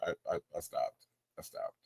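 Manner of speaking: soft, low trailing confession; sheepish admission. Real, not acted. Streamer admitting they skipped the lyric, soft and sheepish. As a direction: let it shrink away almost to nothing.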